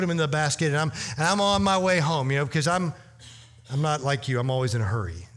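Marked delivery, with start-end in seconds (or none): none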